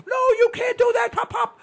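mock child's shriek, very high and loud; granddaughter going crazy. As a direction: imitate a frantic little girl scolding grandpa. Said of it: "she goes crazy".

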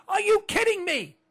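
loud disbelieving yell. Pretend. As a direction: shout it in disbelief. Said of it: "no one's yelling, no one's screaming".

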